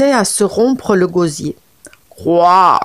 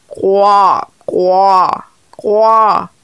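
croaked frog calls, a little louder; frog croaking; 3 dB louder, much slower than usual. Acted. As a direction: croak like a pond of frogs. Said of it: "Les grenouilles croissaient à vous fendre les oreilles". (coassements de grenouilles)

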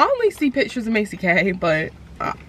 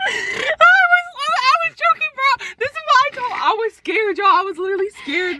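squealing through laughter, much higher; half laugh, half cry of embarrassment; laughing high-pitched. Real, not acted. Embarrassed by her quoted words.

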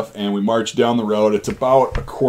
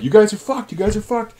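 much higher gloating mock threat; taunting drill sergeant; 12 semitones higher than usual. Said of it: "they're like".

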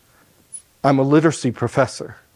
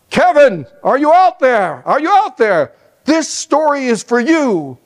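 higher, louder call across a crowd; 9 semitones higher, 4 dB louder than usual. Retold or imitated. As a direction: call out to him over four hundred people. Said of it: "yell out".

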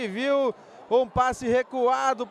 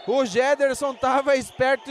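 ordinary delivery

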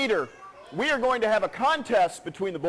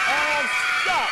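shouting; 4 semitones higher than usual. Real, not acted. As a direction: cut the crowd off with a shout. Host cuts off the cheering crowd.

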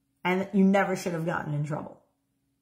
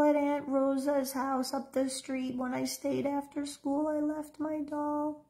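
put-on high, soft, whispery five-year-old; small frightened child near a whisper; a five-year-old afraid she left her doll; scared little girl; 8 semitones higher, 4 dB quieter than usual. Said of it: "assume the feelings and the emotions of that little five-year-old girl".

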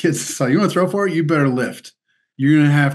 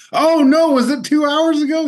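hammed-up "oh no", much higher and louder; mock surprise; 4 dB louder than usual. Coach realizing he stood the family up, hammed-up shock. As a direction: play the coach's fake shock.

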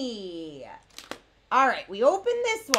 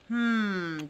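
ordinary delivery

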